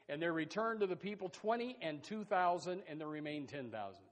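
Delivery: usual speaking voice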